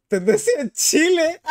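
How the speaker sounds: high-pitched, breaking into laughter; astonished laughing. Real, not acted. Streamer seeing the trend in Chile, astonished laughing. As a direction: read it out and burst out laughing.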